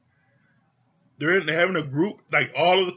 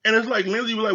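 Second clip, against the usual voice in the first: ordinary talk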